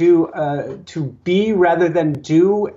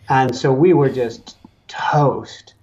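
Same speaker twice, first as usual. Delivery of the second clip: whisper; 3 semitones lower, slower than usual. Real, not acted. Exhausted confession, whispered.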